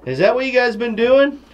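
high, playful voice for the animals; pet-talk scolding the goats in a high voice. Real, not acted. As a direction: tease the goats like pets in a high voice. Teasing the goats about the feed.

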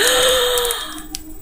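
sharp high gasp of delight. Real, not acted. Vlogger spotting kit pieces for her boys, high gasp. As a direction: gasp like you just spotted treasure.

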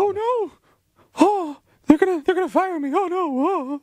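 mock panicked pop star, high-pitched.

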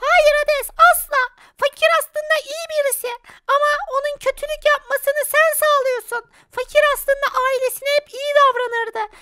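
high, steady protective voice; high spirit voice. Acted. Good spirit Selena confronting Hades, high voice.

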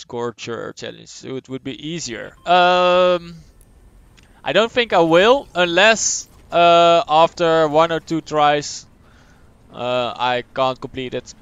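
usual speaking voice

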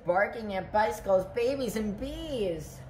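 plain reading voice

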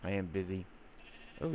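ordinary talk, nothing marked

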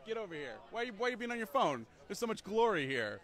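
high, playful hawking to passersby; calling out. Pretend. Booth author to distracted passersby on phones, playfully calling out. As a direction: coax them over with mock indignation.

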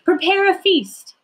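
high, loud, celebratory order; storybook father's jubilant announcement; excited command. Acted. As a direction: call it out to the servants with joy. Father joyfully orders a feast.

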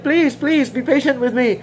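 high pleading voice; begging servant. Acted. Servant begging the master, high and pleading. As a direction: plead like a desperate debtor. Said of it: "he begged the master".

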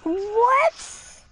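high, rising "what"; incredulous. Real, not acted. Streamer hearing a viewer's nonsense rhyme, incredulous. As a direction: let the question shoot up in disbelief.

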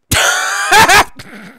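high-pitched burst of laughter; real laugh, shooting up high; high cackle. Real, not acted. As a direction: cackle at your own bit. Laughs at own statue bit.

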